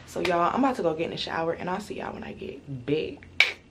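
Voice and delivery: ordinary talk, nothing marked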